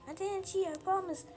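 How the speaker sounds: ordinary delivery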